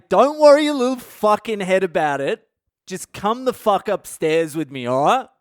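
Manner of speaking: acted exasperated bossing; full of attitude. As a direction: demand it with blunt, pushy attitude. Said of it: "with the same attitude?".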